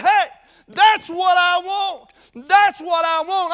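drawn-out preaching shout; fervent declaration. Retold or imitated. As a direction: shout it out slowly like a boy who found what he wants. Preacher reliving his boyhood decision.

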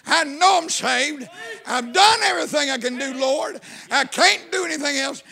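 reenacted cry of joy to God; exultant praise. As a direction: proclaim it jubilantly, reliving the moment. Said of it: "And I said".

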